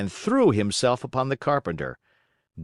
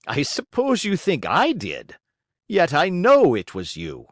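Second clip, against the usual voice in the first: higher, louder accusing tone; quarrelling; 6 semitones higher, 5 dB louder than usual. A narrator's reading. Geppetto accuses carpenter in the quarrel, higher. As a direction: insist you know it was him.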